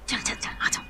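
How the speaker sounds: soft, voiceless whisper; hushed urging. Acted. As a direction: hurry her along under your breath. Hurrying friend away in secret.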